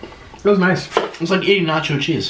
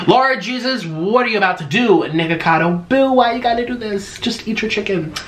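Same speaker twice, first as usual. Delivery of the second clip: high, mock-scolding viewer voice; mock exasperated viewer; 6 semitones higher than usual. Imitating a viewer scolding Nikocado, high and mock-exasperated. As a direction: whine at him like a fed-up fan. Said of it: "you saw the title and you're like".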